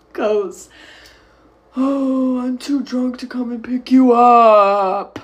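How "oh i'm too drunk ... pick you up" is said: put-on drawn-out drunken wail, swelling louder; mock drunk boyfriend. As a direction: whine it out slowly like a mocked drunk. Mocks drunk boyfriend on the phone.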